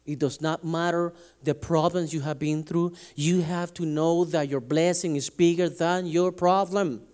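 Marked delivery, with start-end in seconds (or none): none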